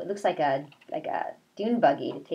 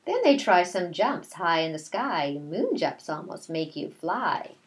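slow, sing-song read-aloud; reading to children; 2 semitones higher, slower than usual. A narrator's reading. Teacher reading a picture book to her class, slow sing-song. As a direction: read the rhyme slowly to the class.

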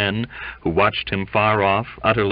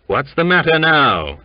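loud, higher-pitched question; demanding, raised voice; 7 semitones higher, 6 dB louder than usual. A narrator's reading. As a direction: cut into the commotion. Newcomer breaks into the commotion.

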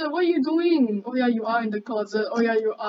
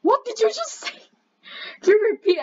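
high, laughing what did you say; laughing disbelief; 9 semitones higher, faster than usual. Real, not acted. Friend says something absurd, high laughing disbelief. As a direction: crack up in disbelief.

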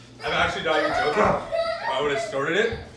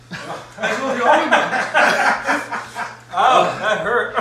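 laughing through the burn; giggling while it hurts; laughing in pain; 5 semitones higher, 3 dB louder than usual. Real, not acted.

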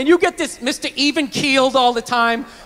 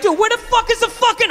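frustrated outburst; 9 semitones higher than usual. Retold or imitated. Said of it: "you're like".

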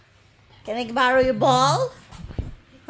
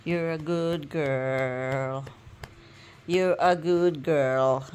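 tender praise; 7 semitones lower, slower than usual. Real, not acted. Praising the dog softly.

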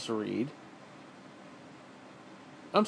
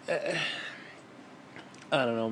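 normal talking voice

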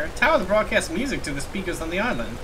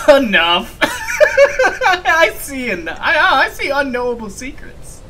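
high, loud outburst breaking into laughter; laughing while acting; 11 semitones higher, 7 dB louder, slower than usual. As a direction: cry out dramatically, cracking up. Cracking up during dramatic reading.

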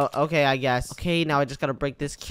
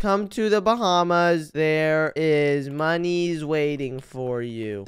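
slow salesperson pitch; much slower than usual. Acted. Reading out his fake pamphlet.

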